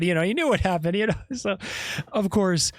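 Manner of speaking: usual speaking voice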